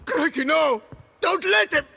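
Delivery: loud, high, terrified begging; frantic cry not to be hanged; panicked plea. Acted. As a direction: plead for your life in panic. Begging before the hanging.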